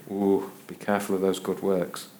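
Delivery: imitated churchgoer's hushed caution; wary churchgoer. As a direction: warn warily, starting on a drawn-in "Ooh". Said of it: "I've heard churchgoers say".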